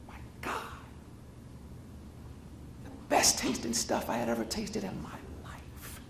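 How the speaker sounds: whispered, savouring wonder; awed whisper. Retold or imitated. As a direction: whisper it, overcome by the taste. Savouring remembered ice cream.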